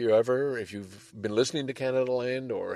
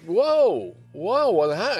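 startled; 9 semitones higher, 5 dB louder, slower than usual. Retold or imitated. Seeing car in road.

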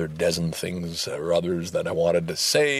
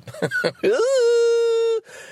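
loud high burst of laughter; delighted loud laugh; big loud laugh; 4 dB louder than usual. Real, not acted. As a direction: let out a huge laugh. Loud laugh after stunt.

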